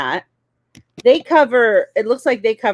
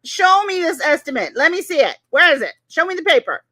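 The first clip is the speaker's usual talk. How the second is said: raised pitch, insistent challenge; indignant demand; 4 semitones higher, 4 dB louder than usual. Real, not acted. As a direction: demand the proof, opening loud and staying high. Demanding proof of the estimate.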